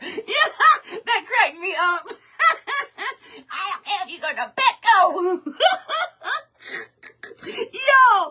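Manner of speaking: high-pitched laughter all through the words; laughing hard. Real, not acted. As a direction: laugh through every word. Laughing at own joke.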